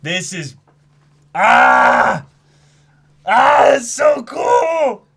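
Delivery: high, loud gushing cry of awe; excited shout. Real, not acted. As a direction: overcome with fan excitement. Hyped about the reveal.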